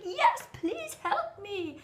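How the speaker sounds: high, drawn-out acted plea; mock desperate plea. Struggling traveler answers helpful Parisians, mock desperate. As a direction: stretch out a hammed-up cry for help.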